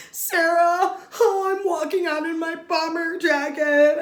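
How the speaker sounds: prissy imitation of a contestant; mocking Sarah.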